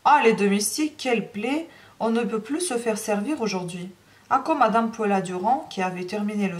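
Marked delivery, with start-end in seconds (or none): none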